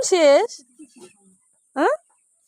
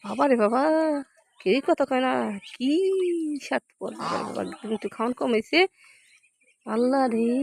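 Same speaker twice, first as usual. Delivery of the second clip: low, half-whispered grumbling; hushed aside; 4 semitones lower, 7 dB quieter than usual. Real, not acted. To herself about the selfish cow, hushed grumble. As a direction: whisper the gripe to yourself.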